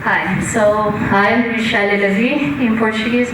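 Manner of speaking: usual speaking voice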